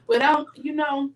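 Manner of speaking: ordinary delivery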